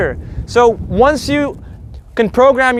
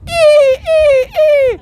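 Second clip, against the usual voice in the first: very high squealing ee like a dolphin; high-pitched dolphin ee cry; slower than usual. Shown as a demonstration. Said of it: "the I is an orgasmic dolphin".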